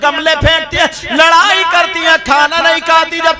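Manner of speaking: loud, pleading sermon voice; preaching at full force. Acted. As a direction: call out to the sisters. Preacher addresses girls in crowd.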